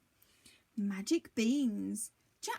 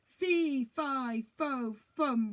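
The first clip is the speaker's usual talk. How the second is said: louder booming chant; roaring giant; 2 semitones higher, 4 dB louder, much slower than usual. A narrator's reading. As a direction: roar each syllable. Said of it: "roared the giant".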